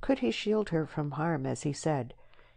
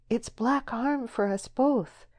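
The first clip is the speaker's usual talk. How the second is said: high, slow fearful warning; voiced woman in superstitious alarm; foreboding warning; 7 semitones higher, slower than usual. A narrator's reading. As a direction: warn slowly with pitched-up dread. Deborah warns of harm.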